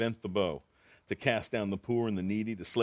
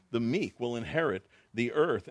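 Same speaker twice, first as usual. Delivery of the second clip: usual speaking voice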